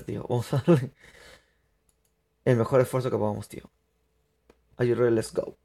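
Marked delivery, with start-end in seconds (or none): none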